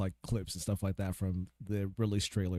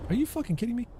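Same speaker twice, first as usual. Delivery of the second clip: exasperated outburst; 13 semitones higher, faster than usual. Real, not acted. Dies in game, exclaims.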